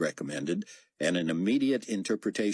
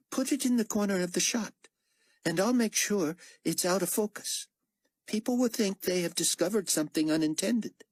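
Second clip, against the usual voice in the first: raised, lightly voiced character line; 14 semitones higher than usual. A narrator's reading. Chang proposing a trick to the team, lightly voiced. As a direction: pitch it up for the character. Character dialogue, read aloud.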